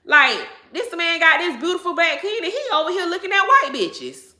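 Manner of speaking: lightly acted hurt girlfriend asking herself; imitating a hurt woman. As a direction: voice the insecure wife, a bit exaggerated. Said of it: "you gonna start saying".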